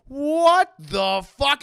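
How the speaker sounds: loud, very slow, high what the fuck; shocked disbelief. Retold or imitated. Mother sees the doll has no batteries, loud slow shock. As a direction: drag out the shock. Mom finds empty battery compartment.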